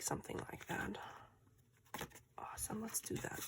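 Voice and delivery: soft whisper so no one wakes; hushed, keeping it down; quiet at night. Real, not acted. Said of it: "I can't talk too loud".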